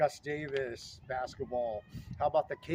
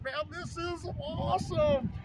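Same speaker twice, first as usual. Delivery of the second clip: much higher gleeful exclaim; 12 semitones higher than usual. Retold or imitated. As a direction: relive the thrill of the rivalry. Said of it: "And I'm like".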